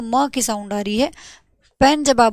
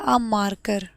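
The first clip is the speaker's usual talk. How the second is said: slow example; 3 semitones lower, 4 dB quieter, much slower than usual. Shown as a demonstration. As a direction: say a marker slowly and clearly. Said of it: "मैंने बोला". (धीरे से उदाहरण)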